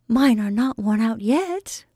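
light laughing reply, slow. Acted. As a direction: tease him back through a laugh. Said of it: "laughed Belle".

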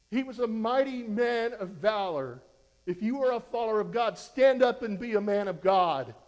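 loud, driving call to stand up; fired-up preaching. Acted. Preacher urging the church to stand for God. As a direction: preach it hard at the congregation.

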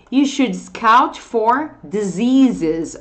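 very slow, careful English; deliberately slow teacher reading; slow model sentence. Shown as a demonstration. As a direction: spell it out for learners word by word. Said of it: "Esse for aqui, ó".

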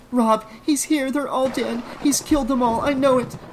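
high-pitched hysterical outburst. A narrator's reading. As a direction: blurt it out in terrified hysteria. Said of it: "Trish stammered hysterically".

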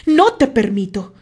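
loud angry outburst; shouting. A narrator's reading. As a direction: cut him off with a shout. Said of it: "le gritó Terry".

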